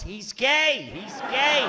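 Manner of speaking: punchline emphasis. Acted. Punchline reveal.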